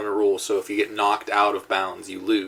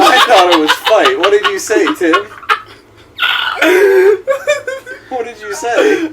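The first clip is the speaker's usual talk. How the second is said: loud, higher, laughing protest; laughing disbelief; 9 semitones higher, 9 dB louder than usual. Real, not acted. Caught mishearing the question, laughing loud protest. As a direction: crack up while demanding an answer. Mix-up of fuck and fight.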